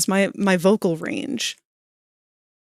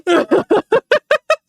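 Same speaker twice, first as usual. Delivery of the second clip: high laugh; 13 semitones higher, 5 dB louder than usual. Real, not acted. Laughing after her angry demo.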